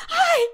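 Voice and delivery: very high, loud "¡ay!"; startled high yelp; retold scream. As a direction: imitate a shocked shriek.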